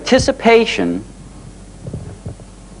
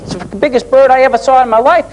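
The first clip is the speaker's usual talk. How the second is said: put-on excited boy; 10 semitones higher, faster than usual. Boy telling dad about the owl.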